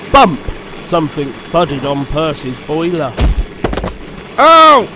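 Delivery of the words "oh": high, loud jolted "oh"; startled exclamation. Acted. Percy hit by a falling parcel, startled cry. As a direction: jump at the sudden thud. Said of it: "he exclaimed in a muffled voice".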